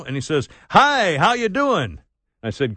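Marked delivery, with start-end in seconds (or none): 0.7-2.0 telemarketer. Retold or imitated. Imitating telemarketer tape.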